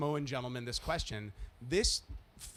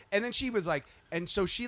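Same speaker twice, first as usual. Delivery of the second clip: normal talking voice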